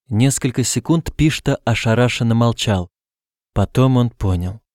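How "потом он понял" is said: ordinary delivery, nothing marked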